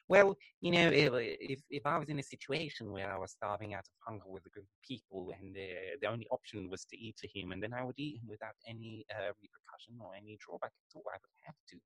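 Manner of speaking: a hint of a rambling old Englishman; old British taxidermist. Imitating the taxidermist on the documentary, soft and rambling. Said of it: "he talks like this".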